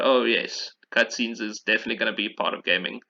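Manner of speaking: ordinary talk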